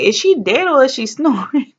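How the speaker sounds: mother's incredulous question. Retold or imitated. Mother walking into the room on her snoring daughter, incredulous.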